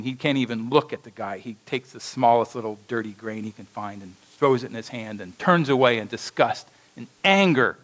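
ordinary talk, nothing marked

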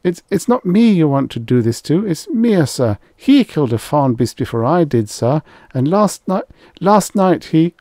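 high, loud, stammering desperation; desperate plea. A narrator's reading. Rye blaming another hunter to escape being bait, desperate. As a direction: plead frantically, pitch leaping far up.

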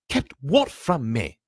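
slow, high, loud question; eager boy. A narrator's reading. Boy demands the secret from the adults, eager. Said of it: "said Harry eagerly".

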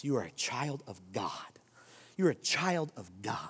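hushed, unvoiced whisper of assurance. Real, not acted. Pastor whispering identity to the congregation, hushed whisper. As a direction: breathe it to them gently.

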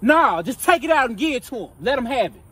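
ordinary talk, nothing marked

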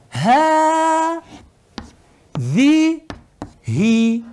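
"ha, di, hi" split into slow, held syllables; long vowel. Shown as a demonstration. Teacher shows the unwritten alef, long held syllables. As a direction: hold "ha" long and high, then "di", then "hi". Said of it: "también aquí tenemos una alef".

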